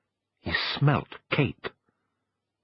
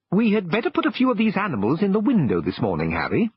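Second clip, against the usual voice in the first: lightly raised voice of a shopkeeper, brisk; a shopkeeper calling to his helper, a bit higher; 8 semitones higher than usual. A narrator's reading. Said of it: "said a voice".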